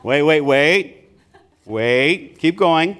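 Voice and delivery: drawn-out, rising waits with long gaps; mock stalling parent. Father playfully holding off his kids' talk, mock stalling. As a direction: stretch each wait as if holding someone off.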